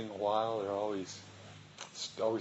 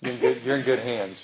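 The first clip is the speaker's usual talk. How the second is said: laughing; 3 semitones higher, 9 dB louder than usual. Real, not acted. Reassuring newcomer, laughing.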